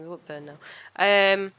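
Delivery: usual speaking voice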